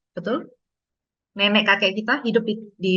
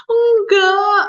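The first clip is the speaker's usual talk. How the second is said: put-on high, loud little-kid "no"; sulky child; 11 semitones higher, 8 dB louder than usual. Child refusing parent's guess, high and sulky. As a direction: answer like a stubborn small child.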